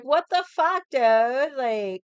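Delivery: high, loud mock outrage at a friend; incredulous yell. Friends questioning the brother's marriage, high loud disbelief. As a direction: throw it at him like everyone did. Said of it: "everybody was kind of questioning".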